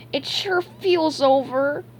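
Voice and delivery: mopey, sniffly cartoon voice; sniffling. A narrator's reading. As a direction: drag it out dejectedly with a sniff. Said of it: "he sniffed".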